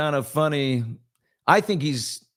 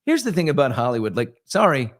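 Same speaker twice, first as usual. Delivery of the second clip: usual speaking voice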